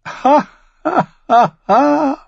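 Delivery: high, ringing woman's laugh; musical laugh. Acted. Red woman laughs at dragonglass, a high musical laugh. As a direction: laugh in high, separate peals. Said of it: "Das Lachen der roten Frau klang wie Musik".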